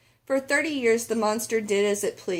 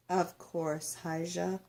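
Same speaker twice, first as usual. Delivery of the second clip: quieter, gentle grandma reply; soft grandmother; 3 semitones lower, 8 dB quieter, slower than usual. Acted. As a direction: answer the child softly, like an old abuela. Grandmother answers softly.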